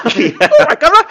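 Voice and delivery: loud, high-pitched caricature Cockney; harsh Cockney accent. Retold or imitated. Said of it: "That's a harsher".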